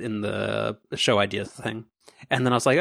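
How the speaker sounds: ordinary talk, nothing marked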